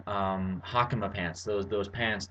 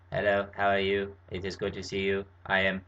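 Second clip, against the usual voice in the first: British accent through a flat vocoder; a robotic British greeting; 4 semitones lower than usual. Shown as a demonstration. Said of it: "British ones".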